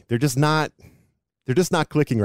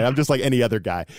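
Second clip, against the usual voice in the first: ordinary delivery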